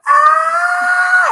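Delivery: high, loud shriek; panicked scream. Retold or imitated. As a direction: scream in helpless alarm. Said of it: "and I'm like".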